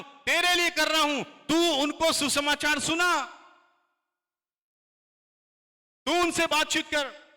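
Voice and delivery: high, loud, impassioned calls; Jesus calling to Mary. Retold or imitated. Jesus from the cross tells Mary not to weep, loud and high. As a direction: cry out to comfort her. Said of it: "ऐसा नहीं बोला".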